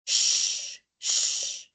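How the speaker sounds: voiceless sh sound held out; hush-like sh, no voice; whispered sh hiss. Shown as a demonstration. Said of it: "I will show each phonetic symbol".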